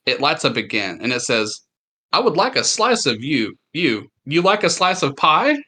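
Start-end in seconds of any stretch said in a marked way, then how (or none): 2.1-5.6 glitching animatronic. Acted. Glitching animatronic repeats line.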